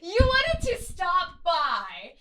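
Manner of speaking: loud, very high, drawn-out incredulous cackling; mocking laughter. Sister mocking Agnes's visit to Ma, shrieking derision.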